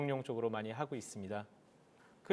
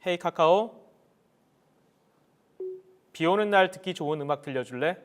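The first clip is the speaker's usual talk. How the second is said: talking to voice assistant; 3 semitones higher, 6 dB louder than usual. Real, not acted. Commanding smart speaker.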